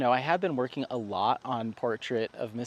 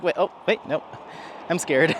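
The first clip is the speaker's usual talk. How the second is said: louder, higher comic alarm; mock scared dog; 5 semitones higher, 5 dB louder than usual. Owner voicing the dog freezing at a snake, mock scared. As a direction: play the dog losing its nerve, lightly. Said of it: "gets really scared".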